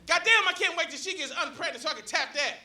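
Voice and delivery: high, held-back mock holler; mock yell. Barber yelling out about a passerby, high mock yell. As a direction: imitate a yell without the volume. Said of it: "stops cutting my hair to yell".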